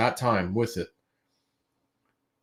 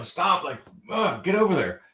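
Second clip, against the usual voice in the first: retold annoyed grumble; 5 semitones higher, 4 dB quieter than usual. Acting out shoving the child away.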